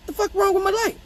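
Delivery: high-pitched put-on gripe; mock bewildered complaint. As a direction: squeal it in baffled annoyance. Acted dad finding light broken.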